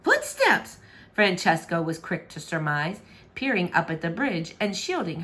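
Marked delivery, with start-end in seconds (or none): 0.0-0.8 surprised princess. Acted. Princess surprised hearing steps.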